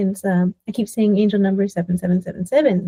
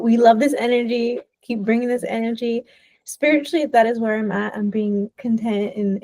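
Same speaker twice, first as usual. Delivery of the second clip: usual speaking voice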